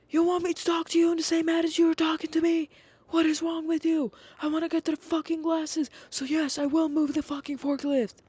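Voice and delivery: high, loud mock old-man outburst; yelling grandpa. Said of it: "he keeps yelling at me".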